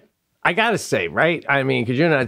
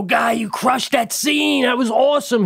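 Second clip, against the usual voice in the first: gushing praise; 8 semitones higher than usual. Pretend. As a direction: gush like an eager admirer. Acting out gushing to the star.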